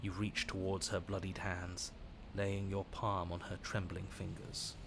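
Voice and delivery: calm low storytelling voice; story narration. A narrator's reading. Narrates the game scene.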